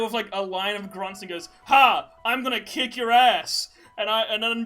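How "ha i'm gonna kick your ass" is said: higher, much louder gloating villain taunt; mock villain shout. Retelling Faber's taunt at the kids, loud mock villain shout. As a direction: bellow it like a cartoon baddie gloating.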